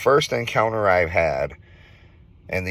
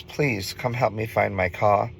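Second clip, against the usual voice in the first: higher-pitched imitation of a British-accented woman; British-accented stranger, voiced higher; 4 semitones higher than usual. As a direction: plead for help in a put-on British accent. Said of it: "she had some kind of British accent".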